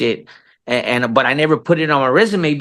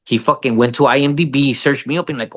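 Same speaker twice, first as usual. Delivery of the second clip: normal talking voice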